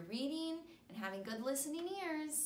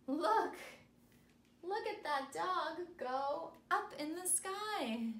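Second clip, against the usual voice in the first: slow, high, sing-song picture-book reading; animated storybook voice for young kids; reading to children; 4 semitones higher, much slower than usual. Acted. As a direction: read it big and bright for little listeners. Reads picture book to students.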